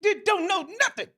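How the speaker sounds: high, rising hammed-up scold; mock scolding elder. Host acting out Gregory berating the young comic, mock high scold. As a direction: jeer it like a fussy old man.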